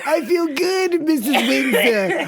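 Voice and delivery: hammed-up sweet old woman; old lady voice. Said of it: "you talk to them like this".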